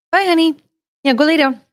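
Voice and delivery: high, loud send-off; calling to pet. Real, not acted. Owner sending the dog to lie down, calling out high. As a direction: call it out brightly to a dog walking away. Calls after her dog.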